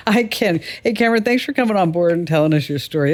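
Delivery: ordinary talk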